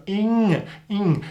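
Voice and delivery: "ing" halfway between N and G. Shown as a demonstration. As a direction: soften the G in "ing", not dropped. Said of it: "somewhere in between N and G".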